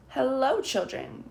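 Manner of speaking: a shaky call out. A narrator's reading. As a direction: wobble the greeting nervously. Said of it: "calls in a shaky voice".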